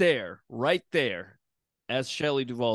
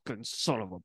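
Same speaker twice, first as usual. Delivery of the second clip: mild mock angry mutter; slower than usual. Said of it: "and he's like".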